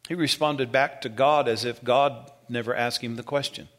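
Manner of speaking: normal talking voice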